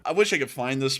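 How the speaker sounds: ordinary delivery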